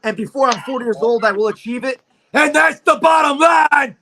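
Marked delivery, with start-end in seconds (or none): 2.3-4.0 loud, high, slowed showman's shout; shouted catchphrase. Pretend. Fighter capping his championship boast on air, shouted catchphrase. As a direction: belt it out like a wrestling promo sign-off.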